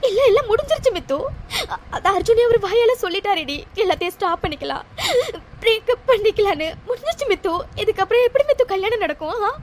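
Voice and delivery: tearful, breaking voice; crying. Acted. Girl crying to her friend over the breakup, tearful. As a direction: let the tears break through the words.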